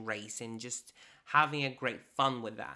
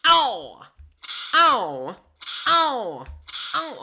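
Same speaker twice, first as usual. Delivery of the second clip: loud playful yelps; hammed-up yelps as she cracks the whip; mock whip yelps; 10 semitones higher, 11 dB louder, much slower than usual. Joking about the handsome boyfriend, loud mock ow.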